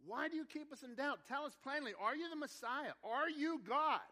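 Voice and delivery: loud, high-pitched interrogation; demanding confrontation. Acted. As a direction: press for an answer, building to a shout on the last question. Acting out the crowd confronting Jesus.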